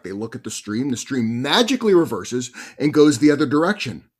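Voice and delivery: ordinary talk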